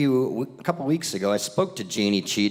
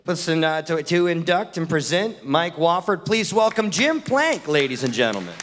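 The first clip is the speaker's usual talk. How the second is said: higher-pitched emcee introduction, peaking on the name; stage announcer; 5 semitones higher than usual. Acted. Host welcoming the presenter to induct [name], announcer style. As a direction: build up to the presenter's name, then settle.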